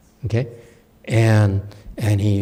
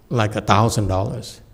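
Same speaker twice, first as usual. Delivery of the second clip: ordinary talk, nothing marked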